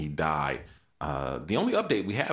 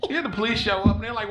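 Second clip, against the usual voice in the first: ordinary delivery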